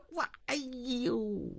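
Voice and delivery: long, slow groan of embarrassment; drawn-out, mortified "aiyou"; dismayed groan. A narrator's reading. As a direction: groan as if caught out. Caught out, lost for words.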